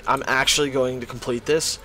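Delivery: ordinary delivery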